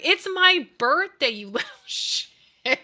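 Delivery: high, loud birthday then a laughing insult; mock outrage. Mother retelling scolding her sons, mock outraged. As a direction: scold the kids playfully. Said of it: "I'm like".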